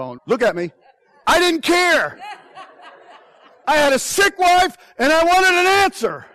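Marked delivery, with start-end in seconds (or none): 1.3-6.3 raised, insistent declaration; higher and louder, driving the point home; emphatic. Real, not acted. Pastor defending himself to the congregation, loud and emphatic. Justifying urgency over sick wife.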